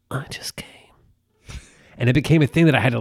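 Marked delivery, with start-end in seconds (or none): 0.1-0.6 soft whisper into an ear. Retold or imitated. Husband whispering a joke in his wife's ear, whisper. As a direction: whisper it like the ad slogan. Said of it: "I just whispered in her ear".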